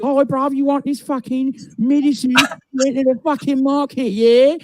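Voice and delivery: high, hammed-up London geezer accent, brash. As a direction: put on a cheeky London accent, bruv-style.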